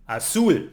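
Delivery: "azul" stressed on ZUL; last-syllable stress. Shown as a demonstration. Teacher giving an example word, "azul" stressed on ZUL. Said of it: "emphasize the last syllable".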